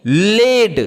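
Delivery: लेड stretched out, with a long ए in the middle; teacher's drawn-out model of लेड, rising then falling; blended phonics word. Shown as a demonstration. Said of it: "एआई का उच्चारण ए".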